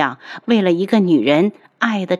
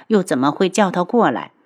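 usual speaking voice

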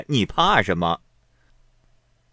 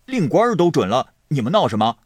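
loud, high, hurried comeback; defiant retort; 5 semitones higher, 6 dB louder, faster than usual. A narrator's reading. Xue Pan silencing the complaining guests, defiant retort. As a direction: throw it back at them loudly. Snaps back at the objectors.

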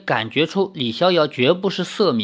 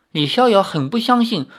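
plain reading voice

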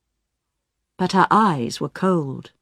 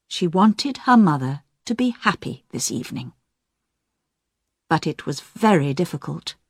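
usual speaking voice

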